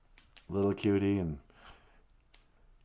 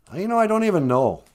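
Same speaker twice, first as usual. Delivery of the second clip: sheepish fox; 9 semitones higher, 6 dB louder, faster than usual. Acted. Wagosh admits ignorance.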